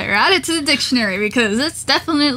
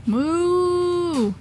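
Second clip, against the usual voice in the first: long, higher-pitched moo; mock cow moo; 6 semitones higher than usual. Player imitating the cow in the game, mock mooing.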